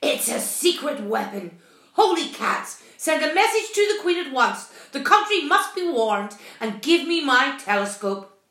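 loud, urgent captain sounding the alarm; alarmed cry. A narrator's reading. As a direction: bark out emergency orders, rising in pitch. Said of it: "cried the captain".

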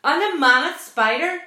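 high, very loud amazement; incredulous cry. A narrator's reading. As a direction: blurt out the next horror at full voice. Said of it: "screamed the captain".

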